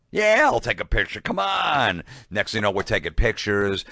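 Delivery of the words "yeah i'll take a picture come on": higher, louder mock Dice; Dice impression. Dice agreeing to a picture with fans, mock voice. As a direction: ham up Dice suddenly giving in. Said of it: "And he's like".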